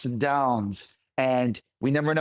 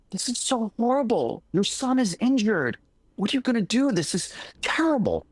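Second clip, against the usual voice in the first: high, fussy lament; fretting neighbor; 8 semitones higher than usual. Retold or imitated. Storyteller voicing neighbors over the broken leg, high and fretful. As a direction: wring your hands as the neighbor, pitch up.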